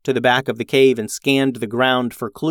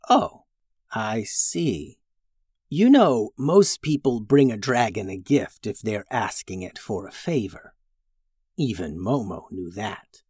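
quiet, scolding dragon; soft, lecturing dragon; 3 dB quieter than usual. Acted. Dragon voiced by reader.

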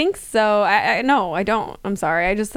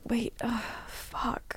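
hushed, sinking swear under the breath; dismayed realization; 3 semitones higher, 12 dB quieter, much slower than usual. Retold or imitated. Retelling his regret at being tied down, quietly dismayed. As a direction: let the voice drop away into a near-voiceless curse. Retold man realizes he is trapped.

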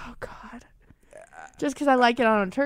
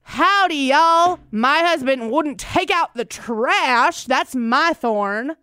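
cowboy voice; 5 dB louder, much slower than usual. Shown as a demonstration. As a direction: ham up a Texan cowboy, stretching every word. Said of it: "I'll give you an example".

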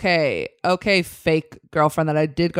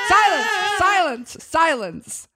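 high call for silence, then settling; commanding; 8 semitones higher than usual. Acted. Boss to laughing staff, commanding call for order. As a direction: cut through the laughter, pitched high.

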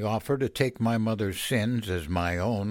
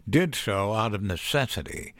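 plain reading voice

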